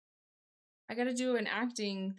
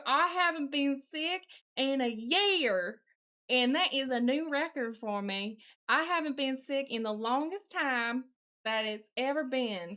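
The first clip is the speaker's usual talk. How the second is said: put-on Southern accent. Streamer answering chat about being sick, put-on Southern accent. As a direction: keep up the mock Southern accent. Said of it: "I can't do nothing but a Southern accent".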